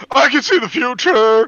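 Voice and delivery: high, loud prophetic proclamation; dramatic shout. Shown as a demonstration. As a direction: declaim it like a villain's prophecy. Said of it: "let me do this one".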